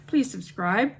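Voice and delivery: ordinary talk, nothing marked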